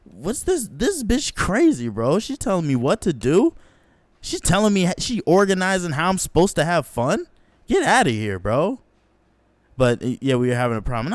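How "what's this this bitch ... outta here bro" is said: indignant rant. Retold or imitated. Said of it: "I was remembering".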